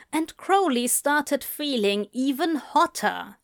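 plain reading voice

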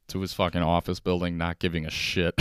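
ordinary talk, nothing marked

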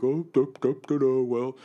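hammed-up mock parent voice. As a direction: lampoon the clueless parents. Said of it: "and were like".

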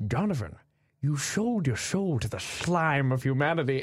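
slow Scottish Sean Connery voice, every S said as "sh"; "sold" and "soul" as "shold" and "shoul", slow and grave; Sean Connery impression. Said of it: "he says everything with an S H".

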